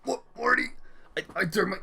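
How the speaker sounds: loud, high, rapid-fire cartoon voice, stumbling over words; Rick impression. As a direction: put on a high, shouting, stammering character voice. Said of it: "do a really good Rick voice".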